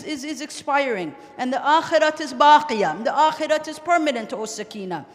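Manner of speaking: high, loud, pleading declamation; impassioned preaching lament. Acted. Father urging his daughter to have patience, impassioned. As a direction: plead with full force through the grief.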